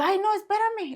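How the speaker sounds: high-pitched mock plea to wait; pitched well up, imitating herself pleading; retold plea. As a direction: act out a pleading protest. Acted plea to the neighbour.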